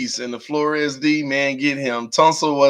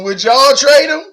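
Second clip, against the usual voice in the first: higher and louder, punch the word trade; excited question; 9 semitones higher, 6 dB louder than usual. Real, not acted. Streamer asking chat about the trade, high and loud. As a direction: call it out eagerly to the chat.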